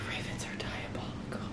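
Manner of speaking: voiceless, hushed disbelief; stunned whisper. Real, not acted. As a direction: whisper it under your breath. Stunned reaction to the book.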